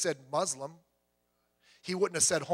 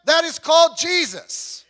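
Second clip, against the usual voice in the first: higher, louder, slow preaching; preaching proclamation; 9 semitones higher, 11 dB louder, slower than usual. Acted. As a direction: proclaim the name with full force.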